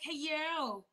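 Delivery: put-on baby voice. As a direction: coo it like talking to a toddler. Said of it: "I got my baby voice on".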